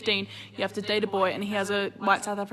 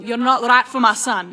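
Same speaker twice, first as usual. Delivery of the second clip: mock scolding; 2 semitones higher, 8 dB louder than usual. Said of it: "she'll scream at you".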